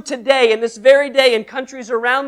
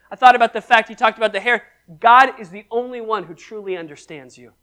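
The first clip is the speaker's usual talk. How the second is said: ordinary talk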